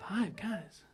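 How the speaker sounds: hushed "Five guys?" in mock awe; whispered disbelief. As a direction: breathe it out, stunned. Stunned reaction to clerk.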